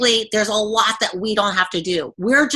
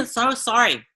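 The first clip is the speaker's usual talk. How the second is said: apologizing with a scratchy throat; strained after coughing; swallowed a bug; 2 semitones higher than usual. Real, not acted. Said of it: "Oh my gosh".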